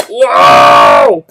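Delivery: loud low whoa shout; booming whoa, loud and low; real shout. Real, not acted. Big shout.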